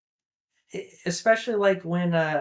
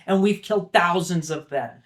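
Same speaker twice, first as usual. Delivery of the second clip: louder, mildly heated mock yell; retold scream; 6 dB louder than usual. Son screaming back at his father, as a mild retold yell. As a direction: throw it back as a half-voiced retort. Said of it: "And Hiccup screams at him".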